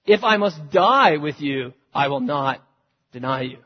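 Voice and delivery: loud, slow and insistent; emphatic. Acted. Peter vowing to Jesus, loud slow emphatic. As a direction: plant each word, loud and stubborn. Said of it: "He gets emphatic".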